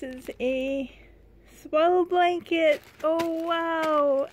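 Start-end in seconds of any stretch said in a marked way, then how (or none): 1.6-4.3 high, loud thrilled cry; excited. Real, not acted. Vlogger unwrapping a blanket for her doll, excited cry. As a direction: squeal over the new blanket.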